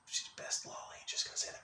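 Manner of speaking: hushed confession; furtive whisper. Pretend. Admitting a favorite after crying blasphemy, furtive whisper. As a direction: whisper it as a guilty aside.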